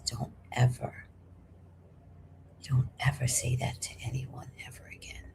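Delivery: low, near-whispered warning; menacingly hushed, with deliberate pauses; quiet threat. Retold or imitated. Client pointing scissors back at hairdresser, hushed threat.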